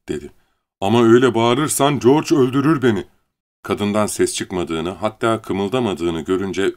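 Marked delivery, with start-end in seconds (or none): 0.8-3.0 high, loud, anxious protest; fearful appeal. A narrator's reading. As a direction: plead in fear of George. Lenny fears George's anger. (korkuyla, telaşla)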